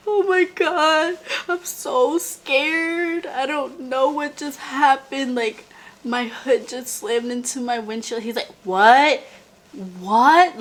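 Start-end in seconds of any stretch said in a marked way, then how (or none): 0.1-8.2 high, slow, tearful panic, voice cracking on "Oh my God"; hysterical crying. Retold or imitated. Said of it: "I'm hysterical". 8.6-10.6 shocked. Retold or imitated. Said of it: "he's in shock too".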